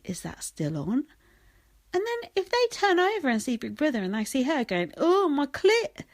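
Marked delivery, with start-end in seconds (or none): 0.0-1.0 mock whisper. Imitating people's disbelieving reply. 5.0-6.0 mock crude moan. Imitating housemate's vulgar moan.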